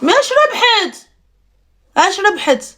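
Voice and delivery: sharply high disbelieving what did she win; mock outrage at the friend's claim; incredulous.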